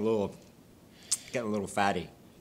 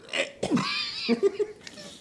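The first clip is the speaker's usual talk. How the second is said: high shrieking laugh. Real, not acted. Laughing fit over smelly beer.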